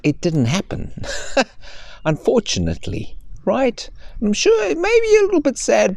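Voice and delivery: bright sing-song storyteller voice for a little one; reading to a child. Acted. Parent recording a bedtime story, sing-song and bright. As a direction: coax and soothe a child.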